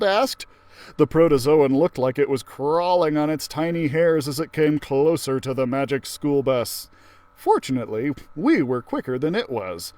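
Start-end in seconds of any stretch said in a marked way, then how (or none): none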